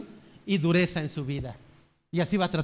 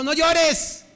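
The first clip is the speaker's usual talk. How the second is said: a high, loud order not to cry; stern father's shout; 9 semitones higher, 5 dB louder than usual. Retold or imitated. Father shouting at his crying son, high and loud. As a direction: bark it like a strict father.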